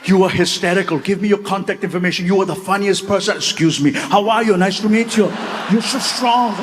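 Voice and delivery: put-on effusive praise in an Austrian accent, then greetings; gush, then switch to the next Austrian-accented hello; Arnold Schwarzenegger. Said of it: "and he grabbed it".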